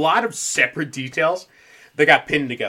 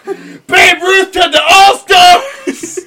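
very high, stretched-out sports holler; triumphant yell; 14 semitones higher, 12 dB louder, much slower than usual. Acted. Host as bat-swinging movie brawler, yelling in triumph. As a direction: scream it like a hammed-up announcer.

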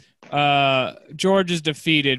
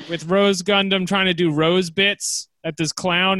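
ordinary talk, nothing marked

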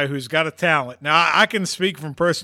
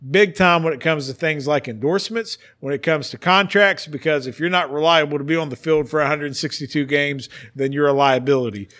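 usual speaking voice

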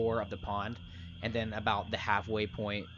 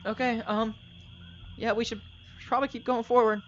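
much higher, louder NPC voice; Darius voice; 10 semitones higher, 3 dB louder than usual. Acted. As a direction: play the nervous companion Darius. Darius answers the party.

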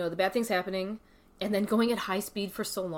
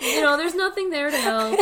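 laughing through the words; 8 semitones higher, 8 dB louder than usual. Real, not acted. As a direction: chuckle while telling it. Laughing about the dangerous hike.

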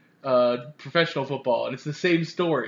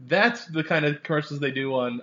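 ordinary talk, nothing marked

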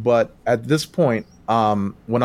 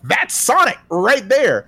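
high, drawn-out excited exclamation; excited recognition; 10 semitones higher, slower than usual. Retold or imitated. Fan recalls spotting the Sonic-style twirl, excitedly exclaiming. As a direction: burst out with delighted recognition, slowly and high. Retelling his thrilled reaction to game.

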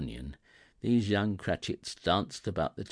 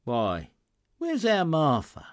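cheerful call; 8 semitones higher, 7 dB louder than usual. A narrator's reading. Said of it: "cried Bob Cratchit".

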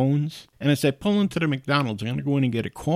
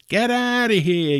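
drawn-out, high-pitched retold yell; higher, stretched-out mock yell; imitated yelling; 10 semitones higher, 4 dB louder than usual. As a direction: imitate a kid yelling someone away. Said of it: "he starts yelling at this guy".

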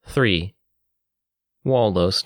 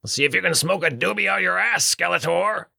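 much higher, insulting dwarf voice; 10 semitones higher than usual. Acted. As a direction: fire it back as a cocky dwarf.

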